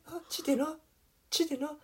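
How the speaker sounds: a hint of mocking laziness; mocking lazy call. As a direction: call from bed without bothering to get up. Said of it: "有点嘲讽".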